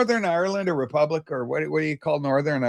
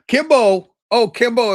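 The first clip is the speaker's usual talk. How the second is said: louder, higher fond call; 6 semitones higher, 7 dB louder than usual. Real, not acted. To friend Kimbo, an excited louder call. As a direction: call his name like he's across the room.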